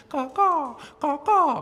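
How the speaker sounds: high, loud crow calls falling off; crow caws. Pretend. Crow calling to her friends, high caws.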